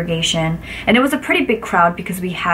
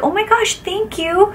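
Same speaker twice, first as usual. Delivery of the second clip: much higher, gushing gratitude; delighted thanks; 12 semitones higher than usual. Retold or imitated. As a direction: squeal thanks for the free fruit. Free melons arrive.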